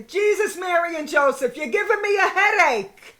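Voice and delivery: hammed-up harried mom; exasperated mother. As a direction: play a fed-up mother. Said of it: "My mother would say".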